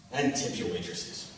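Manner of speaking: soft repeat. Retold or imitated. Drunk guy repeating the line to the crowd, low and soft. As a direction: mumble the repeat as an afterthought. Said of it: "He said it twice like that".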